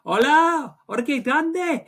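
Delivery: put-on high, loud Spanish-accented fan cheer; Spanish fan chirping. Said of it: "definitely in a Spanish accent".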